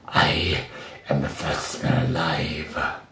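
very low, slow put-on monster voice; voice impression. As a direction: drop far down and draw the words out. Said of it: "with that one, right, his voice is actually modulated".

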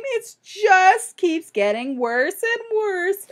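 a mother near tears, slowly; distressed mother. Acted. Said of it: "She began to sob".

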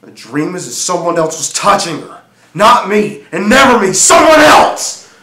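rising from firm voice to loud, high shout; building jealous anger. Acted. Jealous husband telling of another man touching her, building to a shout. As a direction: build steadily until the last words burst out.

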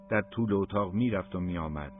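ordinary delivery, nothing marked

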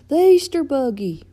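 high and loud, falling at the end; big storybook excitement; excited exclamation. A narrator's reading. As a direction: exclaim it eagerly. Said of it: "Mater exclaimed".

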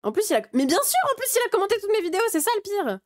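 high-pitched, rushed outburst; indignant exclamation. Real, not acted. Streamer realising the critic commented on all the videos, high indignant outburst. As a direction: burst out high and fast, incredulous.

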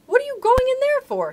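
very high, loud cry of protest; alarmed cry. A narrator's reading. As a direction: shout after someone going the wrong way. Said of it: "he cried".

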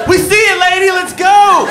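high, loud mock yell at the screen; hammed-up yell of impatience; exasperated yelling. Comic yelling at Dora to hurry up, high loud mock yell.

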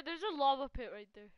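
ordinary talk

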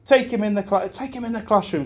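a light take on a flustered teacher; old teacher's fuss. Retold or imitated. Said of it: "and she says".

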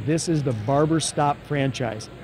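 usual speaking voice

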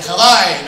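loud Hawaii as "ha-VAI"; Greek pronunciation. Shown as a demonstration. Proud Greek demonstrating Hawaii, loud Greek pronunciation. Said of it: "because I'm Greek".

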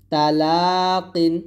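তালাকিন with light t, long stretched লা; thin ta. Shown as a demonstration. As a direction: say তালাকিন slowly with a thin open first syllable. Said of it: "তাটা পাতলা হবে".

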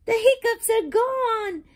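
excited. A narrator's reading. Happy ending of story.

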